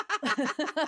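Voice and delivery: bright high burst of laughter; a real amused laugh. Real, not acted. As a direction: laugh at the friend's mistake. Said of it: "I don't know alcohol".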